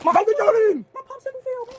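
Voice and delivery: slow, high, drawn-out whine; whining wail. Real, not acted. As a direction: wail it like a loss. Wailing about dropped popsicle.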